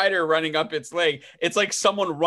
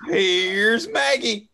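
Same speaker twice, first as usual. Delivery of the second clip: high, slow drawn-out announcement; raised sing-out, hammed up; theatrical entrance; 6 semitones higher, much slower than usual. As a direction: stretch it out like a showy reveal. Mock dramatic entrance announcement.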